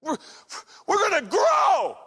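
loud, high mock proclamation; bold announcement. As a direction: shout it to the congregation like a bold vision. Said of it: "you say something crazy to your congregation".

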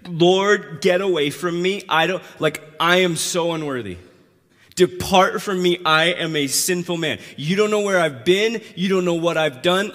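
acting out an overwhelmed, unworthy sinner pushing someone off; distraught protest. Peter falls down, pushes Jesus away.

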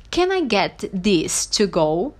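slow, word-by-word English request; model phrase. Shown as a demonstration. Teacher showing how to order takeaway, slow and clear. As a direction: enunciate slowly for learners to copy. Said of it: "pode dizer assim".

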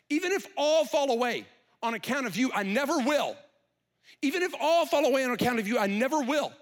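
loud, high, proud insistence; loud proud boast; boastful vow. Acted. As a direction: Peter swears he will never fall away, loudly. Peter's boastful vow, said twice.

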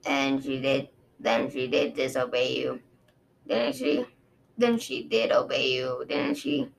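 low, measured guard recounting; a dragon guard explaining to his queen, low and sober; Osprey, low and grave. Acted. Osprey voiced in story.

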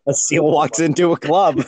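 laughing. Real, not acted. Retelling joke through laughter.

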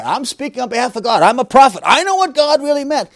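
a hammed-up self-proclaimed prophet; mock self-appointed prophet. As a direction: play a puffed-up prophet claiming God's mind. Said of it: "I'm gonna put myself in the shoes of God".

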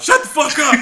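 loud, high yell back; retold yell. Recruit yells back at whoever is rushing him, retold yell. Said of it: "I just yell out".

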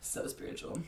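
hushed mock reverence; whispered.

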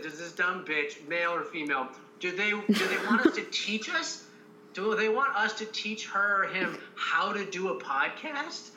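mock scornful inner voice. Retelling to cohosts, a mocking inner put-down. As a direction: sneer it like you can't believe them. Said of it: "I would have said".

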